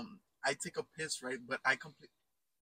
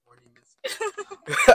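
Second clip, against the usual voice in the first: drawn-out joke word; 10 semitones higher, 13 dB louder, much slower than usual. Pretend.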